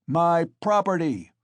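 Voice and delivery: loud, high claim of ownership; defiant shout. A narrator's reading. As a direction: shout back at the crowd. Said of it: "shouted Mikolka".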